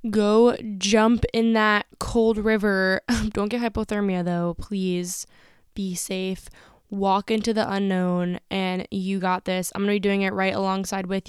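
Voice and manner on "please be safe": soft whisper, caring; whispered plea. Real, not acted. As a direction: whisper it like a gentle warning. Caring sign-off to listeners.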